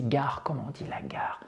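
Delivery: hushed whisper to oneself; pensando consigo mesmo. Acted. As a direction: whisper it like a learner searching for the word. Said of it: "vous réfléchissez".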